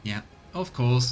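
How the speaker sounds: ordinary talk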